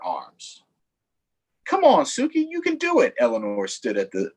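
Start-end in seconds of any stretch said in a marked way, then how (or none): 1.6-3.1 lightly voiced, higher encouraging girl; a hint of a cheerful girl. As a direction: cheer the dog on. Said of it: "Eleanor encouraging again".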